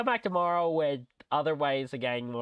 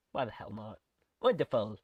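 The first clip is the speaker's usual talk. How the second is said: ordinary delivery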